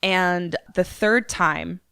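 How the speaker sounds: ordinary delivery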